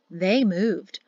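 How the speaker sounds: usual speaking voice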